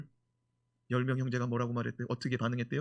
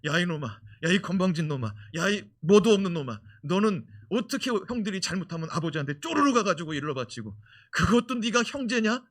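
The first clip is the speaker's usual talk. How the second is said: brothers yelling; 4 semitones higher, 7 dB louder than usual. Retold or imitated. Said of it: "분명히 때리면서 그랬을 거예요".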